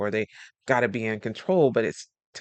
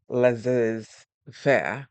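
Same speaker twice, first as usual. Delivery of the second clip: attempted French accent; 4 dB louder, much slower than usual. Shown as a demonstration. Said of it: "trying to say it with a French accent".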